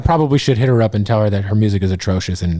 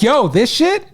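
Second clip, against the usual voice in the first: hyped; 10 semitones higher, slower than usual. Retold or imitated. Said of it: "But I was like".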